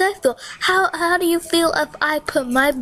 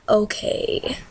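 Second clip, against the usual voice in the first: whispered okay; 7 semitones lower, 4 dB quieter than usual. Real, not acted. Whispered aside.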